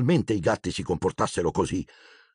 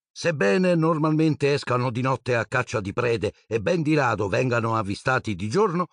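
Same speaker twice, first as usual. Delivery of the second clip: higher, formal broadcast read; TV newsreader; 5 semitones higher than usual. Acted. Newsreader closing the bulletin for viewers, formal broadcast voice. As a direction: present it like the evening news.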